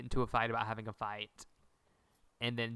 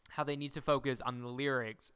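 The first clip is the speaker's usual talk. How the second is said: ordinary delivery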